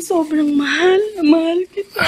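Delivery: high, emotional declaration; tearful love. Acted. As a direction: say it through happy tears. (naiiyak na pagsabi ng pagmamahal)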